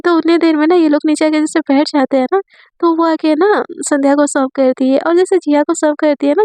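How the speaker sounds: ordinary delivery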